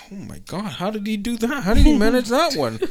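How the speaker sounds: high, gushing proud parents; impressed parents. Retold or imitated. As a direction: play the proud, amazed parents. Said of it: "parents in the crowd like".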